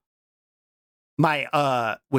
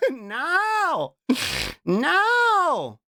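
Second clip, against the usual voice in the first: dismayed cry; 9 semitones higher than usual. Real, not acted. Protesting at the video, high cry.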